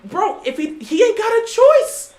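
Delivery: high, drawn-out outburst; exasperated protest. Real, not acted. Fan defends a quarterback who keeps getting hit, exasperated protest. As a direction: burst out in the quarterback's defence, pitch jumping up.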